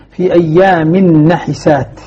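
نحسات with a short i after the ح, "nahisaat"; voweled ح in نحسات, long final aa; kasra on the ha. Shown as a demonstration. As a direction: slowly recite نحسات as na-hi-saat. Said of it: "نحسات بكسر الحاء".

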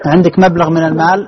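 loud, high checking question. Real, not acted. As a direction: speak up to confirm what the caller means. Host checks caller's unclear account.

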